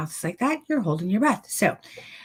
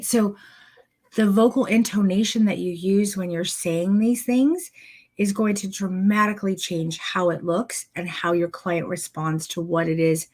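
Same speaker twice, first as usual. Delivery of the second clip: usual speaking voice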